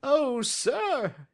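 very high, quivering-with-emotion little man; emotional cry. A narrator's reading. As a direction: cry out in high-pitched desperate hope. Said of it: "he cried".

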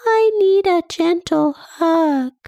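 little body voice asking for a hug; sad body voice. Acted. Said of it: "This is your body saying".

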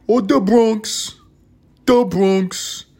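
put-on slow, high, drawn-out Bronx accent on "the Bronx"; exaggerated Bronx drawl. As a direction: stretch out "the Bronx" like a Bronx local. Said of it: "That's how they talk in the Bronx".

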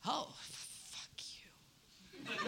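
slow mock whisper of contempt; hushed, hammed-up hostile reaction; whispered hostility. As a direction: whisper the insult, drawn out. Acting out people's hostile reaction.

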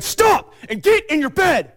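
put-on sudden high, loud shout at a child; angry shout. Retold father shouting at his six-year-old son. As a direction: yell it in his face, fed up.

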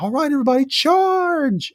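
rally the trolls, hammed up; mock battle cry. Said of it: "So he's like".

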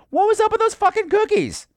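high-pitched baffled complaint; exasperated outcry. Retold or imitated. Everybody's exasperation acted out.

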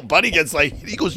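ordinary talk

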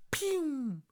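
high drawn-out "pew" sound effect; imitated twinkle zing. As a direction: make a high, sliding cartoon star noise. Imitates anime star appearing.

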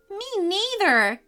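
put-on high-pitched excited kid voice; agreeing boy. A narrator's reading. Calvin agrees with his brother, high and delighted. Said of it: "Calvin agreed".

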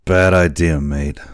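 put-on loud, rough old seaman; old sailor. A narrator's reading. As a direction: warn him like a gruff old salt. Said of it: "said the boatswain".

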